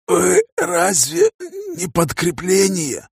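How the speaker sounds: very high, very slow, strained rasp; dying man's rasp. A narrator's reading. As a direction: wheeze it out with the last breath. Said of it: "прохрипел умирающий". (хрипло, умирающим голосом)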